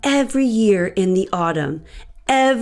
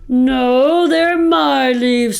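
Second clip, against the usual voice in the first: ordinary delivery, nothing marked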